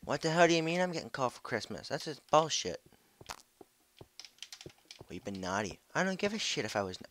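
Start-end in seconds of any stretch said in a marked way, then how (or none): none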